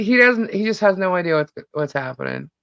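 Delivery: ordinary delivery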